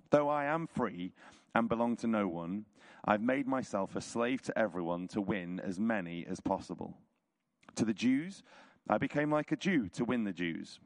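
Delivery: ordinary delivery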